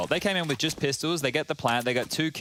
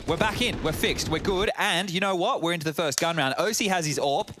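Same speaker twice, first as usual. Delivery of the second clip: brisk, higher live casting voice; play-by-play commentary; 4 semitones higher than usual. Acted. Caster calling the gun round live. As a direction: call the round as it happens.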